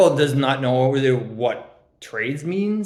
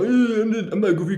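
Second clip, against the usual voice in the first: higher-pitched, huffy denial; angry friend impression; 8 semitones higher than usual. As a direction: mimic the friend when he gets annoyed. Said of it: "that's how Mars talks when you anger him".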